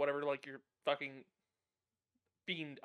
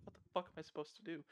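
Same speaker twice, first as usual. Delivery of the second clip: hushed and hurried, helplessly baffled; baffled aside; 8 dB quieter, faster than usual. Acted. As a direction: ask it under your breath, at a loss. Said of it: "with the expression of, like".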